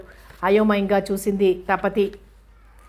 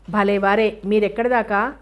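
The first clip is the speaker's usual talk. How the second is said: usual speaking voice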